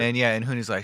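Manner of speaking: ordinary delivery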